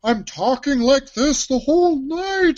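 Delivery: bad Ray Romano impression, pitched high and loud; Henchman twenty-one. Shown as a demonstration. Host shows his taco shift voice, as a cartoon henchman. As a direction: put on a high, whiny Romano-style henchman. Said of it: "a bad Ray Romano accent".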